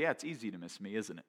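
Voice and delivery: low, soft, knowing God; gently chide, dropping low and soft; God's voice. Retold or imitated. God speaking inwardly to the preacher, quiet knowing voice. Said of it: "I heard God say".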